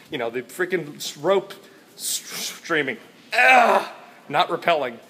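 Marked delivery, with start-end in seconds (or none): 3.4-3.8 loud, high "ugh"; frustrated groan. Real, not acted. Vlogger cannot recall the activity's name, frustrated groan. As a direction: burst out groaning at the forgotten word.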